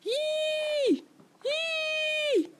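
very high squeaky dolphin cry; dolphin call. Shown as a demonstration. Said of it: "请你学着发出海豚的叫声".

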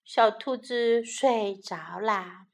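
usual speaking voice

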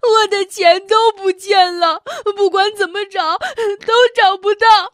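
high, loud sobbing words; crying loudly. Acted. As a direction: wail it through tears. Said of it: "小袋鼠放声大哭起来".

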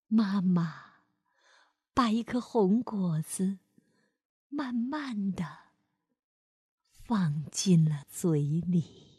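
put-on gentle storyteller reading to a child; lively read-aloud for kids; expressive bedtime-story voice; story narration. A narrator's reading.

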